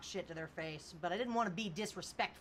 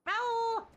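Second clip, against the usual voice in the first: high, loud imitated cat meow; 13 semitones higher, 6 dB louder than usual. Shown as a demonstration. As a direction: call out to the cat like a cat. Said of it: "Every time I meow".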